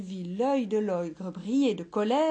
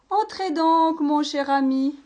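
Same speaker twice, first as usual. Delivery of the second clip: higher, louder hearty welcome; welcoming bookseller; 5 semitones higher, 8 dB louder than usual. Acted. Bookseller welcoming the ogre, hearty and loud. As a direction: greet him like an old friend. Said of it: "dit-il d'un grand".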